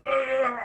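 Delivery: high strangled grunt, played dumb; silly grunt. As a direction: make a dumb noise to dodge talking.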